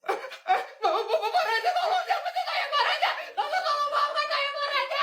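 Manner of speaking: high, whining plea with laughs; whiny high begging. Acted. Fired boss begs the owner, squeaky whining plea. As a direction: beg in a squeaky, pathetic wail.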